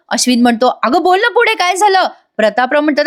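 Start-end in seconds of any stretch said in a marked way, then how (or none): 0.8-2.1 high, anxious prodding; acted urgency. Ashwin urges Priya to go on, acted worry. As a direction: press worriedly for the rest, as in a retelling.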